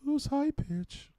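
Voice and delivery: very high, slow mock voice; weird high-pitched voice. Man mimicking how his dad talks now, high-pitched voice. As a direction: squeak it out slowly, stretched high. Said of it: "his weird high-pitched voice".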